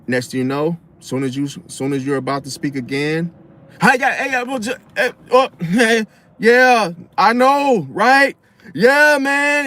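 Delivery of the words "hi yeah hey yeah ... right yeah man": high, loud, babbling over you; hammed-up talker cutting in with hey and yeah; mock chatty interrupter. Imitating the interrupter as he cuts you off, high and loud.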